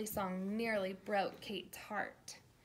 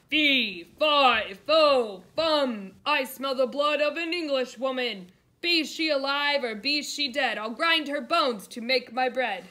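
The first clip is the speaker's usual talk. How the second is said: loud, higher giant's chant; booming giant; 3 semitones higher, 13 dB louder than usual. A narrator's reading. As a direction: bellow each word like a hungry giant.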